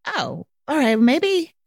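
puzzled second-guessing, pitch climbing; tentative, wondering aloud; mildly thrown by the reply; acted confusion. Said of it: "I get confused. I'm like".

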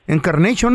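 "Encarnation" with an English "-shun" ending; English pronunciation. Shown as a demonstration. Host explaining what the caller means, "Encarnation" in English pronunciation. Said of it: "eso es lo que quiere decir".

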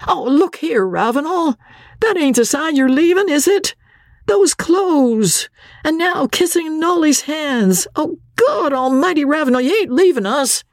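put-on very high-pitched, flustered old captain; alarmed. A narrator's reading. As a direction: blurt it out in high agitation. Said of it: "the look on his face changed to one of alarm".

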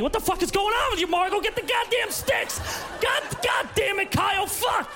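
high, fast screaming mom losing it; furious mom screaming. Acted. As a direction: blow up at the whole family.

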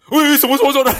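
startled, high and loud; baffled shout of what; confused friend. Retold or imitated. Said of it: "然后他就愣了一下". (惊慌地连问什么)

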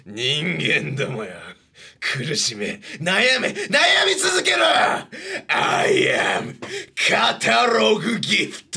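slow menacing villain rising to a shout. Acted. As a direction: play a grand demon lord. Personified villain gag.

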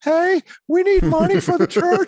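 retelling a nervous squeaky church appeal; nervous high voice. Retold or imitated. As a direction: squeak it out like a scared first-time speaker at church. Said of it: "my voice went real high".

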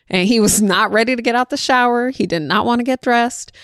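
ordinary talk

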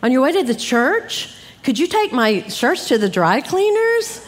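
singsong, wheedling spouse voice; imitated husband. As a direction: lightly mimic a husband asking a favor. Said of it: "my husband would say".

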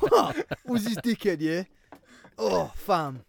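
hammed-up fake Birmingham street voice; Birmingham accent. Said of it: "That was my Birmingham accent".

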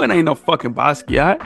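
ordinary delivery

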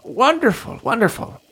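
high, gleeful mad doctor; delighted doctor. Acted. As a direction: purr with delight. Lanfizz delighted.

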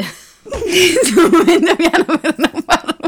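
slow, high, laughing. Real, not acted. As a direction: squeeze it out through laughter. Ride durante la registrazione. (parlando tra le risate)

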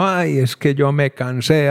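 put-on a light paisa accent with the vowels drawn out; paisa drawl. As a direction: retell the cashier in a stretched paisa accent. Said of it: "hablaba bien arrastrado".

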